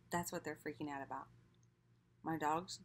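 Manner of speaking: eating a snack; talking while chewing. Real, not acted. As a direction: mouth partly full. Seen 0: Bites cheese at -1; still holds cheese early in words.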